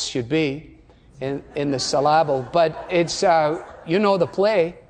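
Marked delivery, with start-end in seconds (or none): none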